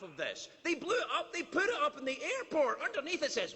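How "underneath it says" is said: normal talking voice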